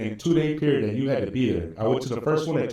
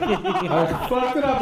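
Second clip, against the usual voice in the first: laughing; 8 semitones higher, much slower than usual. Real, not acted. Laughing repeat of the flub.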